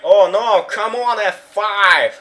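high loud hyped-up yell; excited shout. Real, not acted. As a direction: shout it at the screen. Shouting at big move. (возбуждённый крик)

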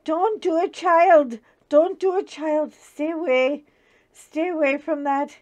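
high, loud pleading warning; heavenly mother, loud and high, begging her child to stay away. Retold or imitated. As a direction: cry out like an anxious old mother from above. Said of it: "My mother in heaven is going".

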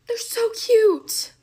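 a gasp and a drawn-out high coo; gushing gasp. Real, not acted. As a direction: melt over how cute they are. Gushing over cute brushes.

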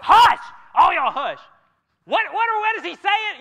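loud, high hammed-up "Hush!"; mother in the pew shushing her kids, loud and high; mock shushing shout. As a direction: shush the kids and strain to hear. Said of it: "she's like".

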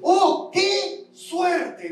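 mock the skeptics' gasp of luck; mock amazement. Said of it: "Muchos podrían decir".

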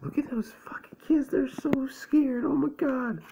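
very high, thin mocking voice; mock-scared falsetto. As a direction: squeak in pretend fright for the kids. Mock commentary on scared kids.

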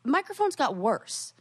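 playfully outraged, way up; high exasperated disbelief. Retold or imitated. Friend retelling her own reaction, mock outrage.

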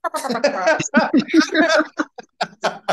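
loud high laugh breaking out; burst of laughter. Real, not acted. Laughing as the host prompts her answer. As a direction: laugh it off, caught mid-thought.

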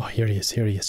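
ordinary delivery

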